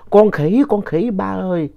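high-pitched alarmed call to Dad; retold frightened cry. Child spots a monkey's arm at night and calls Dad, alarmed cry. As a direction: cry out in alarm, reliving the scare. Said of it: "tôi mới la lên".

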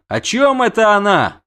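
high, mildly shouted question; prince's shout. A narrator's reading. Prince demands a translation from the witcher, shouting mildly. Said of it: "крикнул князь".

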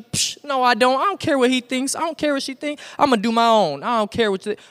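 high-pitched mock defiance, dismissive; defensive high-schooler brushing it off; acting tough. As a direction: imitate a teen pretending not to care. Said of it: "try to act tough and act like".

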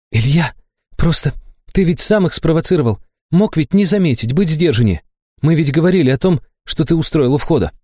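lightly voiced worried girl lecturing; Liza, reproachful. A narrator's reading. (слегка озвученная Лиза, с упрёком)

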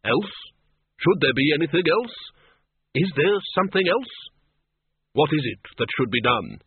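rising, anxious questions; panicky. A narrator's reading. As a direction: let mild panic push each question up. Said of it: "The customer seemed to panic".

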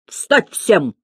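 mildly forceful teacher's command; understated drill command; thundering order. A narrator's reading. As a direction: order the whole class up. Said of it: "гремел Слушкин".

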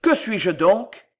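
loud, slow, menacing question; frightening voice. A narrator's reading. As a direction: demand it slowly, enough to scare a child. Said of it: "d'une voix qui eût effrayé un autre enfant". (voix forte et lente, effrayante)

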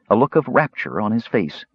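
usual speaking voice

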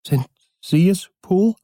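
a frightened servant peers out; anxious voice behind door. Acted. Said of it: "eine Stimme fragte von innen".

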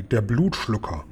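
understated, ominous warning; hushed warning. A narrator's reading. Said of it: "warnte der Guri".

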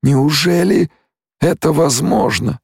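high-pitched disbelief; amazed tone. A narrator's reading. Stilgar marvelling at blind Paul's sight, amazed tone. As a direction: wonder aloud, pitch right up. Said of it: "изумлённым тоном проговорил Стилгар".

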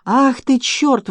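high, exasperated swearing; cursing. A narrator's reading. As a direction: curse in sudden vexation. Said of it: "выругался он".

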